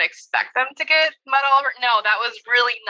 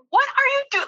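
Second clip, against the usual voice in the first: high, sharp "What are you doing?" in exasperation; exasperated What are you doing. Retold or imitated. Parent catching son covered in mud, exasperated. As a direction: snap it out like a startled parent. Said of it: "my response was completely wrong".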